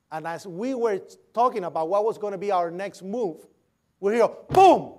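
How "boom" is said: a sudden loud mock explosion, boom; imitated boom. Telling of the impact, a loud imitated boom. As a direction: burst out the boom like the blast hitting.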